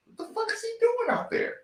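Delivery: high, disbelieving mock commentator; incredulous national commentator. Podcaster imitates national commentators' disbelief, high-pitched. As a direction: throw up your hands in disbelief. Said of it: "was like".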